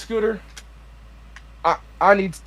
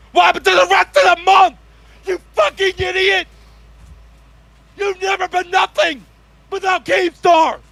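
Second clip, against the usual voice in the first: angry rant; 7 semitones higher than usual. Acted. Ranting at a rival.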